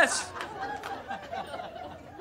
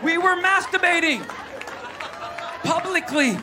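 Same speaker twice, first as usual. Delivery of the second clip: pitched-up comic yell; shouted punchline; 8 semitones higher than usual. Real, not acted. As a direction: yell the pun to the crowd.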